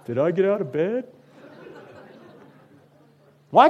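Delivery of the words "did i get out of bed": lightly higher, puzzled voice of the audience; flustered listener. Retold or imitated. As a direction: act out someone groping for their memory. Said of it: "most of you are gonna go".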